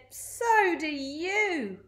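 high, very drawn-out jibe; teasing retort. A narrator's reading. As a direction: stretch it out as a mocking comeback. Crook retorts about the smell.

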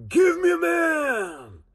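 very high, long-held yell; slow, stretched challenge cry; giant crying out. Acted. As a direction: yell it across a canyon. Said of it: "that giant voice crying out".

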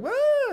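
a very high, startled shriek; hammed-up fright squeal; mock scream. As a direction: yelp like you spotted poison ivy. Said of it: "we're like".

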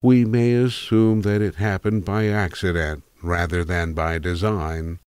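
ordinary delivery

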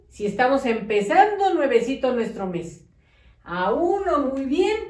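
usual speaking voice

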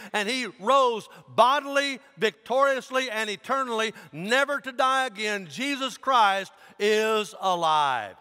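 loud, high, emphatic preacher; full-force preaching. Acted. Pastor declaring the resurrection to the congregation, forceful preaching. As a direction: proclaim it boldly, stretching the big words.

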